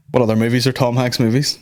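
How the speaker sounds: ordinary talk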